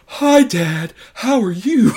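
mocking a bad kid dub; bad dubbed kid voice. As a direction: squeak it out like a badly dubbed child. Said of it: "like, a bad American woman's voice".

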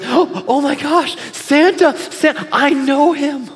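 high, thrilled outburst with stretched words; Elf, all excited. Acted. Elf hears Santa is coming to the store, all excited. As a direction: gasp and squeal it in mock ecstatic recognition. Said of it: "He gets all excited".

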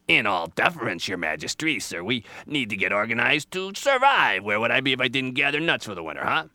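loud, busy animal character; protesting Squirrel. Acted. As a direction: argue back, speeding up at the end.

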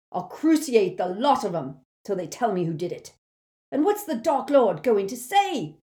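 shrieked. A narrator's reading. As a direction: shriek it in fury and fear. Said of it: "he shrieked".

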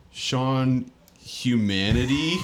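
drawn-out puzzled misreading; Sean Humanity. Retold or imitated. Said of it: "he goes".

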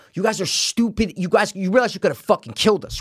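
firm scolding. Retold or imitated. Golfer just talking to careless players, firm scolding. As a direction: tell them off without losing it. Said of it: "that's how I'm talking".

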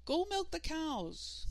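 high, slow call down the hall. Acted. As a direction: call from the other room, motherly. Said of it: "called their mother from down the hall".

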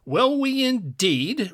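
indignant challenge. A narrator's reading. Giant challenging his companion's plan, indignant. Giants quarrel over their captive.

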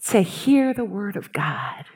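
hushed reverent whisper. Acted. Pastor telling how Martha lingered for God's word, whispering. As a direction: drop to a breath of awe.

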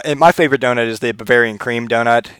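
normal talking voice

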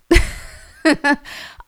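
big high burst of laughter; loud laugh. Real, not acted. Mom laughing at her full throttle mama line, loud and high.

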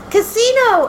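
high, loud retold cheer; playful high-pitched outburst; excited calling out. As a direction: imitate yourself cheering at a roadside sign. Excited car passenger spotting casino signs.

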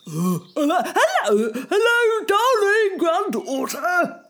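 high, slow fake granny voice; wolf squeaking up to sound like Grandma. Wolf pretending to be Grandma, high fake granny voice. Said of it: "pretending to be Grandma".

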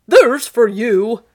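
loud, drawn-out blow of a line; attacking shout. Acted. Sir Andrew to the man he strikes, attacking shout. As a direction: land the words like a punch.

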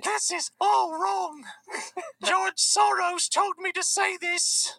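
very high, slow put-on girl's voice; Greta impression. Activist reciting her grievance, slow high-pitched parody. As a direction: draw out each word in mock indignation.

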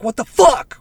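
high, loud shocked cry; shocked outburst. Retold or imitated. Husband hearing his wife upstairs, loud shocked cry. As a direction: blurt it out in shock. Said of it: "And he's like".

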